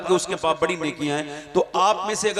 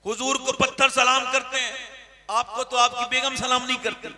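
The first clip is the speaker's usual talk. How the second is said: usual speaking voice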